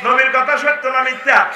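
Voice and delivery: preacher's rising shout. Real, not acted. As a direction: shout the question to the crowd.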